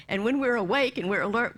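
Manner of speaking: normal talking voice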